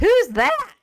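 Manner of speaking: high, loud mock question; exaggerated high-pitched puzzlement; clueless audience. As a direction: squeak it out as the clueless crowd. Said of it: "all of you all would say".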